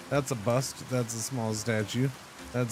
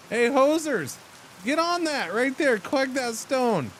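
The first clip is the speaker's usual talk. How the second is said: high, louder call to the workers; mock foreman call; 10 semitones higher, 3 dB louder than usual. Streamer ordering his game workers to collect stone, high mock foreman call. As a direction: bark orders at the little workers.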